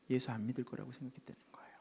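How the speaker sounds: quick hushed whisper. A narrator's reading. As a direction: rush it under the breath. Hushed retelling. (빠르게 속삭이듯)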